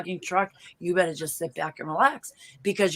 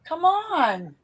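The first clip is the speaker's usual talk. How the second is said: slow, higher call to the dog; 9 semitones higher, much slower than usual. Real, not acted. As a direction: stop the dog from across the room. Scolding the dog.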